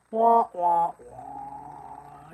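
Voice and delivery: muffled trombone-like wah wah wah; Peanuts adult wah-wah. Retold or imitated. Mocking sad faces on Zoom, droning Peanuts wah-wah sound. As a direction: mimic the Peanuts grown-ups' droning wah. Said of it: "Remember the, uh, Peanuts people?".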